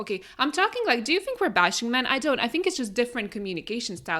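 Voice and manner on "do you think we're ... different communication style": ordinary talk, nothing marked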